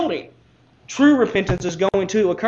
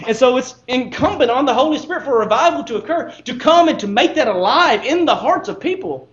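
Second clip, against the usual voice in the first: higher, louder pulpit delivery; impassioned preaching; 5 semitones higher, 4 dB louder than usual. Real, not acted. Preacher tells congregation the Word is made alive, impassioned preaching. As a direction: preach it with rising and falling emphasis.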